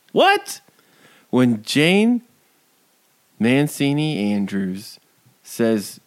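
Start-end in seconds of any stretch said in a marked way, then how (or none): 0.1-0.6 incredulous what. Real, not acted. Outraged disbelief at character.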